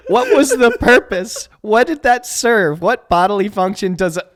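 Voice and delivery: high, loud, incredulous; laughing disbelief. Real, not acted. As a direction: ask it while still cracking up. Asks while still laughing.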